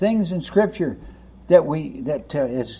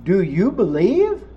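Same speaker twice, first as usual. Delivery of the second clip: a touch of doubting sarcasm, rising on believe; mild sarcastic doubt; sarcastic question. Acted. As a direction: ask it half mocking. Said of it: "full of exasperation and a bit of sarcasm".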